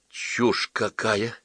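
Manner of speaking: frightened. Acted. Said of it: "испуганно глядя на Сорнякова".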